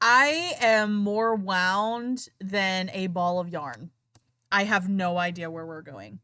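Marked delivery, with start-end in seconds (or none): 0.0-3.9 slow. Real, not acted. Joking about confusion.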